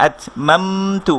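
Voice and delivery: slow, stretched আতমামতু; drawn-out reading. Shown as a demonstration. Teacher shows students the wrong drawn-out reading, slow. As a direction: drag each syllable of আতমামতু. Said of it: "এভাবে বলবো না কিন্তু".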